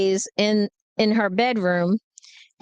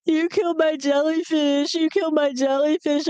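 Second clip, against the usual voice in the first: high, wailing imitation of sobbing; hammed-up tearful accusation; mock crying; 5 semitones higher than usual. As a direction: whine it like a teen crying over her dead pet. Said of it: "she was crying".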